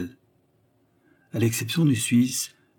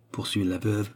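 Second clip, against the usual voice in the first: ordinary delivery, nothing marked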